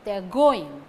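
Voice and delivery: "going" punched loud, "They are" kept weak; stress on going. Shown as a demonstration. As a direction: hit "going" hard. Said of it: "Stress falls on going".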